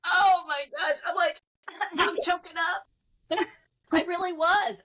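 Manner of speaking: laughing while talking; breathless laugh. Real, not acted. Laughing at her own choking up.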